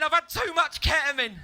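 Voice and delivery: high, panicked mock scream; loud screaming. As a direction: scream it like a wrecked raver. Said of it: "Really loud screaming".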